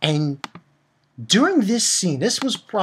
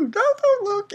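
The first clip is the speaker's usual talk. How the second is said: put-on flustered girl. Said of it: "and saying".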